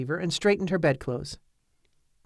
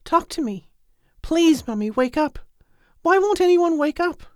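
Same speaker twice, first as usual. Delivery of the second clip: higher, rising to a near cry at the end; desperate pleading; 11 semitones higher than usual. A narrator's reading. Lisbeth begging her mother to wake up, desperate. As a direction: plead harder and harder for an answer. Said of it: "she implored".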